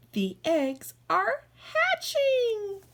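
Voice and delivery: high excited announcement; thrilled. Acted. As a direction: squeal with glee. Eggs hatching.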